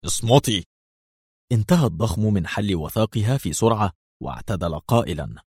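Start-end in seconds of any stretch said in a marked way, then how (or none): 0.0-0.7 curt, mildly rough order; understated harsh command. A narrator's reading. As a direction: order silence with some roughness. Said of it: "قال ليفي في خشونة".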